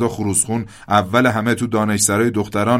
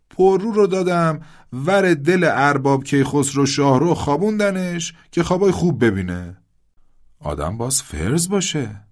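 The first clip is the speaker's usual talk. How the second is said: put-on higher, gruff old king's voice; the old shah; 8 semitones higher, 4 dB louder than usual. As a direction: play the aging shah, reminiscing boastfully. Shah character voice, reminiscing.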